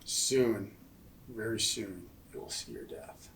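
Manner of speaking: low threatening whisper; menacing whisper. Acted. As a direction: promise his death under your breath. Threatening the villain.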